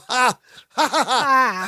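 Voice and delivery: high, spoken-out fake "ha"s; imitated mocking laugh. Co-host imitating Larry and Balki after the door slam, fake laugh. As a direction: act out a duo's defiant laugh. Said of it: "Larry and Balki both go".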